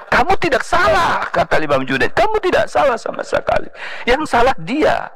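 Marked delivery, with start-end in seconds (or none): 0.1-1.3 high-pitched, insistent teacher; acted emphatic reassurance; emphatic denial. Guru membantah muridnya merasa bersalah. 2.1-5.1 high-pitched, insistent teacher; acted emphatic reassurance; emphatic denial. Guru membantah muridnya merasa bersalah.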